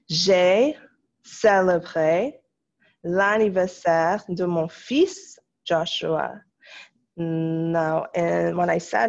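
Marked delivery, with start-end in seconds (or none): 0.1-6.3 "célébré l'anniversaire de mon fils Joshua" read slowly, word by word; slow dictation. Shown as a demonstration.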